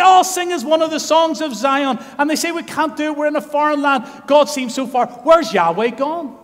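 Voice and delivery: high, loud pleading cry; anguished lament. Retold or imitated. Exiles in Babylon crying out, high and loud.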